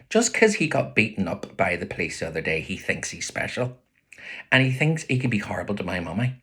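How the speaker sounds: plain reading voice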